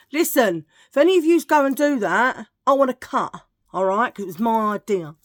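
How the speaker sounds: mock demand. Demanding a cut from viewers, mock loud. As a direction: play the greedy boss.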